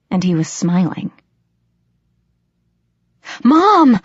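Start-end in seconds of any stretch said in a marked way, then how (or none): 3.4-4.0 high, loud cry of alarm; screamed. A narrator's reading. Daughter finds her mother lying bloodied, screaming in alarm. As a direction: scream out for an unresponsive parent. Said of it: "I screamed".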